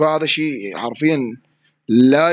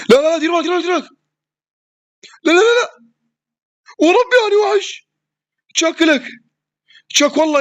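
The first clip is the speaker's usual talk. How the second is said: panicked yelling. Real, not acted. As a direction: yell at your teammate to watch out. Panicked warning mid-fight. (صراخ مذعور يحذّر زميله)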